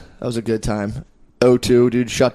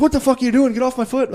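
much higher mock woman's voice, indignant; mock Irish lady; 11 semitones higher than usual. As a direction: play the startled Irish woman, pitched way up. Irish lady snapping at him.

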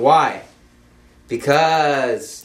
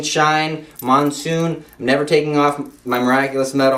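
ordinary delivery

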